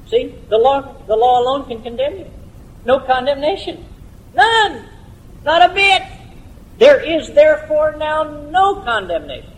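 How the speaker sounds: very high, ringing pulpit proclamation; impassioned preaching. Real, not acted. Preacher proclaims no condemnation to congregation, high and fervent. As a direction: cry out each denial, high and insistent.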